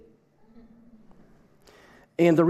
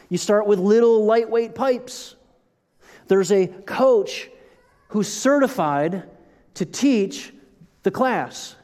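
intense, angry-sounding; 6 semitones higher, slower than usual. Real, not acted. Pastor urging proper CrossFit, sounding angry. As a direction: drive each point hard like a drill coach. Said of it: "I sound angry".

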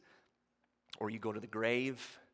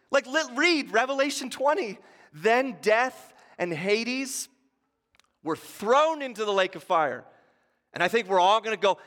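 loud, higher preacher climax building to joy; thundering pulpit sermon, louder and higher; full-force preaching; 8 semitones higher, 9 dB louder than usual. Acted. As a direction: preach it with everything you've got.

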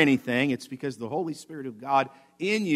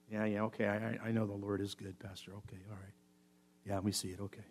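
very low, quiet, monotone mumble; mock indifference, barely audible; half-hearted assent; 6 semitones lower, 17 dB quieter, faster than usual. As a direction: mumble it like a bored congregant. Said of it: "that's not the attitude the psalmist says".

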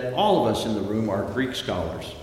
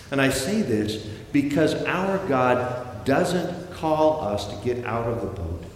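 ordinary talk, nothing marked

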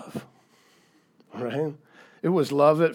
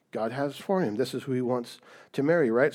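ordinary delivery

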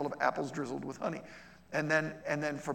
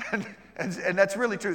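laughing agreement; 5 semitones higher, 7 dB louder than usual. Real, not acted. Laughing at the joke.

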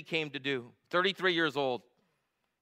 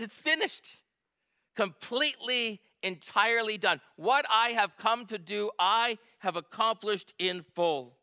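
much higher, slow and deliberate proclamation; Jesus declaring; 7 semitones higher than usual. Acted. Preacher voicing Jesus declaring his work done, slow and high. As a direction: announce it as final and complete.

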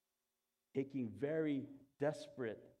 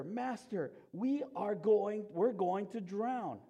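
raised, louder cry for help in a Bible reading; urgent alarm; 7 semitones higher, 7 dB louder, slower than usual. A narrator's reading. Disciples waking their master in the storm, urgent alarm. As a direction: read the disciples' plea with urgency.

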